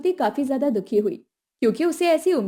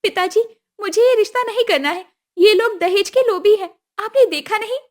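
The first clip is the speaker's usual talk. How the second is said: put-on higher, pleading young woman's voice; a daughter begging her father, pitched up; upset daughter; 9 semitones higher, 5 dB louder than usual. Jyoti pleading with her father, higher and upset.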